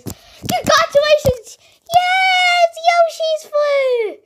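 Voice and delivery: high, loud character cheer. Pretend. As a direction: cheer it out triumphantly. Cheering the winner.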